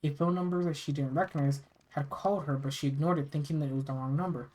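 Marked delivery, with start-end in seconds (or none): none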